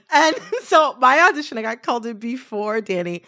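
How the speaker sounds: amused, laughter spilling into the words; laughing. Real, not acted. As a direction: talk on through your own giggles. Still laughing after confessing sabotage.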